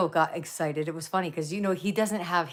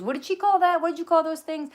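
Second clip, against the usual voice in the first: imitating him much higher and louder, eager; excited husband; 12 semitones higher, 5 dB louder than usual. Wife imitating husband eager about stuffies, high excited voice. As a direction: play him suddenly thrilled and curious. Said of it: "He goes".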